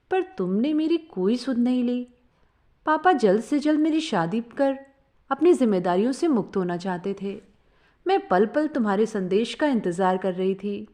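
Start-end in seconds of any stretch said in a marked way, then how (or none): none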